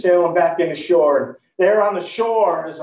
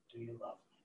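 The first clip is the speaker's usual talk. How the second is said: much softer, gentle asking; tender question; 7 semitones lower, 26 dB quieter, faster than usual. Acted. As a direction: ask it gently, almost under the breath. Christ asks Peter third time.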